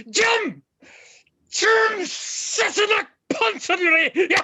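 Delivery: high, loud involuntary blurts; explosive outburst. Acted. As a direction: burst out uncontrollably after a stammer. Vicar's stammer bursts into outburst.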